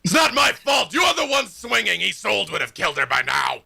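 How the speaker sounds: high, loud, shouted taunt from a blade; taunting sword voice. Acted. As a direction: snap back like a petulant spirit. Sword spirit retorts to Finn.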